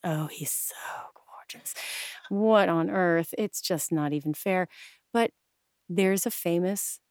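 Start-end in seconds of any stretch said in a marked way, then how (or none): 0.0-1.7 hushed, slowing to a whisper; swooning whisper. Real, not acted. Fan gushing over Cary Grant's looks, swooning whisper. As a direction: drop into a smitten whisper.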